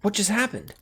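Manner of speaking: high, urgent questions; alarmed confusion. Acted. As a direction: ask in a rising panic. Panic as Akira disappears.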